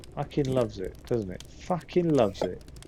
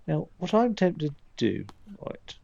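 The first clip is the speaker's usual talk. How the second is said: ordinary delivery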